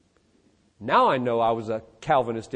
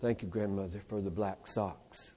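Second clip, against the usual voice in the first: a hint of a sulky boy, much softer and lower; grudging child thanks; 7 semitones lower, 15 dB quieter than usual. Boy thanks grandmother for socks, softly grudging. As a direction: mumble dutiful thanks you don't mean. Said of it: "And he can say".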